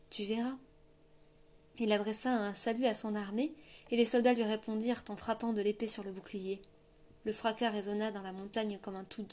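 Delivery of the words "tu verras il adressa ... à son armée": ordinary delivery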